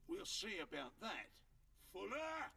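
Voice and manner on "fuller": high shout for a henchman; calling out. Acted. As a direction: yell for backup.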